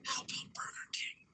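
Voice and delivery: put-on whispered into a child's ear; sneaky whispered suggestion. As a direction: breathe it softly, secretly. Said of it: "so I whisper in his ear".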